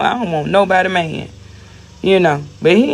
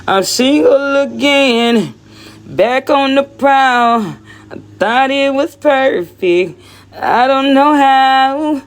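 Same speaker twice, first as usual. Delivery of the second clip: high slow sing-song; 7 semitones higher, slower than usual. Pretend. Singing out single again.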